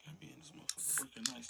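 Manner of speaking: hushed fast whisper to himself; nervous whisper on the night shift. Acted. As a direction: mutter it under your breath, alone in the dark. Whispering alone at night job.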